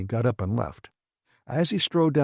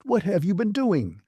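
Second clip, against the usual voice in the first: angry demand; 8 semitones higher than usual. Acted.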